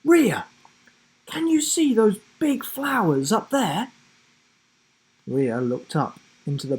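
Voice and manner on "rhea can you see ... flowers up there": put-on a boy calling to his friend about the flowers. A narrator's reading. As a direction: call across to her. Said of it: "Will called out to his friend".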